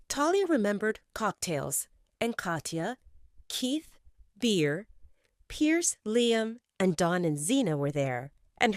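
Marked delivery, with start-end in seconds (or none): none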